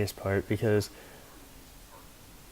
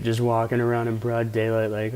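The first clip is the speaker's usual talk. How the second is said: ordinary talk, nothing marked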